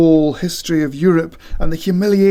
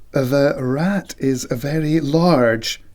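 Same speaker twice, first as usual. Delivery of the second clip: usual speaking voice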